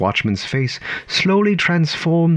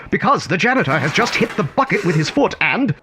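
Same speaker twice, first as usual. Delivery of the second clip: rising alarm; 8 semitones higher than usual. A narrator's reading. Narrator describing the falling bucket, voice rising in alarm. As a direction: build the panic as the bucket falls.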